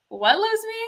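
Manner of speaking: high-pitched imitation of a puzzled singer; puzzled retort. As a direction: mimic baffled questioning from the stage. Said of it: "she's like".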